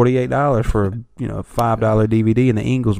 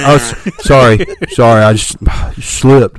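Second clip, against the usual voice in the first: sheepish apology; 5 dB louder than usual. Acted. Acted excuse after slamming door too hard.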